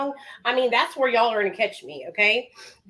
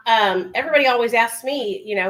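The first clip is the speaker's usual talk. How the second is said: usual speaking voice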